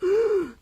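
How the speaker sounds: sharp, high re-enacted gasp; shocked gasp. Teller showing how stunned she was next morning, shocked gasp. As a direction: gasp as if too stunned to talk. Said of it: "I was so, like".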